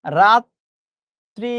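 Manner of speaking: "রাত্রি" as rat... tri, long gap; syllable-split pronunciation. Shown as a demonstration. Teacher demonstrating to class, "রাত্রি" split rat-tri. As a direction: stretch "রাত্রি" very slowly, rat, then tri.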